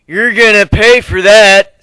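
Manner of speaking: loud, high, slow threat; bully threat. Acted. Third bully threatening the narrator, loud slow menace. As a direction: spit the threat out slowly.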